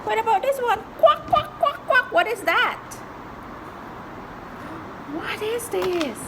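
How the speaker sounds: put-on high singsong for a toddler; reading to a toddler. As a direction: coax a small child gently.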